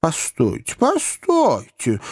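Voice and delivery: anxious plea, second word high and drawn out; fussy lawyer's appeal; exclaiming little man. A narrator's reading. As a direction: stop them, stretching the repeat high. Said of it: "воскликнул маленький мистер Паркер".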